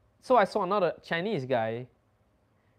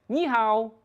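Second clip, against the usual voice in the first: mock greeting; 7 semitones higher than usual. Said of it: "The girls went".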